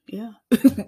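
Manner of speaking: breathy whispered yeah; whispering. Acted.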